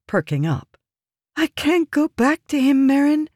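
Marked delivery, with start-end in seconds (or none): 1.3-3.3 very high, voiced plea in place of a whisper. A narrator's reading. Woman confessing to Merrin she dreads returning, high anguished plea. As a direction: plead in a high, distressed voice. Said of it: "Shinri whispered".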